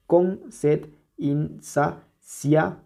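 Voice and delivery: "Con sed insaciable" very slow, a pause after each syllable. Shown as a demonstration. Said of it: "Vamos a contarlas". ("Con sed insaciable" muy lento, sílaba por sílaba)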